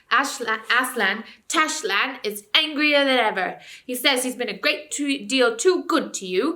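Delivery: loud, high, jeering ape voice; a sly ape raging at the crowd, loud and high; ranting ape. Acted. Ape scolding the animals, loud and high.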